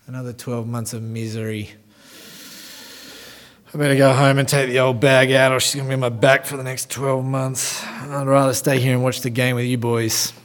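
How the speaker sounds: low sighing grumble, a lightly voiced bored husband; grumbling fed-up husband. Husband in the pub moaning about his anniversary, low sighing grumble. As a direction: sigh it out like a man dreading the evening. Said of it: "he says".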